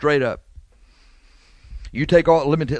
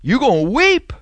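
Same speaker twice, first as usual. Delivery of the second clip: much higher and louder, near a shout; thundering warning; 11 semitones higher, 6 dB louder than usual. Acted. Preacher warns the lying brother he will weep, shouting. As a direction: cry it out over the congregation.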